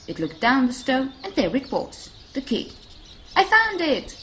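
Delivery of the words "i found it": high, loud, triumphant cry; proud mouse shout. A narrator's reading. Mouse finding the giraffe's lost key, proud shout. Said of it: "the mouse shouted proudly".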